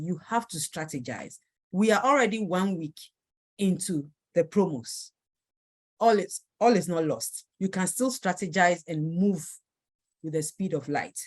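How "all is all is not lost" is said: usual speaking voice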